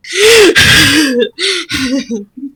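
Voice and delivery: high breathless laugh. Acted. Gil laughing hard in the shower, breathless laugh. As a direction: laugh until almost slipping.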